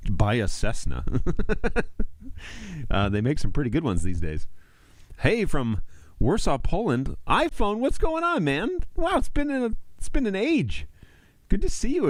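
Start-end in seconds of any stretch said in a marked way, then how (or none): 1.1-2.8 a sudden high squeal of laughter. Real, not acted. Joking about buying a plane, high laugh. 5.2-12.1 much higher, thrilled hello; excited greeting. Real, not acted. Welcoming a returning viewer from Warsaw, much higher and thrilled.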